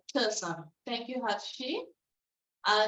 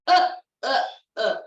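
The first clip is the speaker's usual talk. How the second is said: loud chugging uh uh; mock machine sound; mixer noise; 11 dB louder than usual. Shown as a demonstration. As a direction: imitate a mixer engine. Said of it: "like a mixer".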